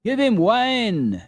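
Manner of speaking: put-on sled driver suggests wine for the fallen boy; sled driver's voice. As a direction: speak up as a rough working fellow. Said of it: "said a fellow who was driving".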